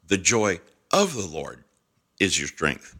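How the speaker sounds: "of the Lord" lifted out between pauses; stress on OF THE LORD. Shown as a demonstration. Preacher demonstrating the real emphasis, stress on "of the Lord". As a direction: punch "of" in "of the Lord", pausing around it. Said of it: "the real emphasis sounds like this".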